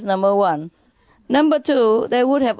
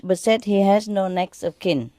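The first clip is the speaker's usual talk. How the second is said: ordinary talk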